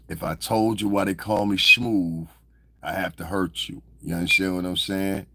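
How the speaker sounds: ordinary delivery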